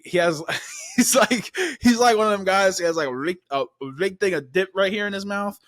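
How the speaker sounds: real laughter in the voice; laughing. Real, not acted. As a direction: crack up while describing him. Cracking up describing the chief's voice.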